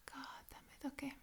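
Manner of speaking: sighed, whispered under the breath; hushed exasperated whisper; whispered curse. Real, not acted. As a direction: mutter it to yourself in defeat. Sighing under breath after dread.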